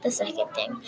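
sudden breathy whisper. Real, not acted. Student presenting sentence parts, whispering the next point. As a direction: drop to a hushed whisper mid-presentation.

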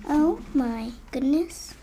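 usual speaking voice

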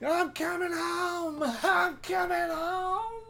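hammed-up dying soul called home; imitating a tearful passing; going to heaven. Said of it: "just say".

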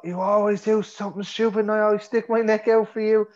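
high, heated, pleading rant; angry argument. Acted. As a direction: fire it back in the middle of a fight. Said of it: "This is the big argument scene".

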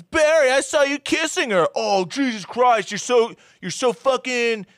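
high, mocking whine; lightly hammed-up clingy complaint; mock Barry. Barry turning the blame on Heather, high mocking whine.

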